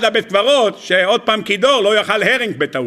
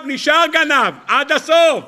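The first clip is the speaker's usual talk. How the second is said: preaching at full force; 8 semitones higher than usual. Acted. Preaching punchline.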